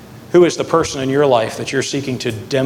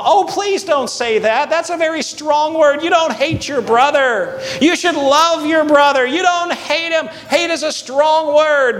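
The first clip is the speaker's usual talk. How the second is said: mom's scolding; 13 semitones higher than usual. Retold or imitated. Mom calling from the kitchen to her son, high scolding voice. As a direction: call out a pleading correction like a mom from the kitchen. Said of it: "from my mom".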